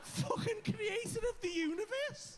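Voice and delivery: high, slow, drawn-out disbelief; exasperated comic repeat; incredulous scoff. Real, not acted. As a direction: stretch out each word in outrage. Incredulous repeat of friend's claim.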